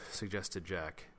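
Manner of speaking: usual speaking voice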